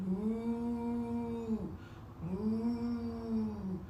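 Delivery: quiet, lowing moo; soft cow moos. Shown as a demonstration. Teacher mooing softly for the kids in cow pose. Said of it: "look up at the sky".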